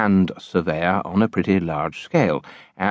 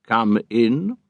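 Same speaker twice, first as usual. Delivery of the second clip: virtuously, not severely; 4 semitones higher than usual. A narrator's reading. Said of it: "not severely, only virtuously".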